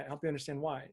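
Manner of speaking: normal talking voice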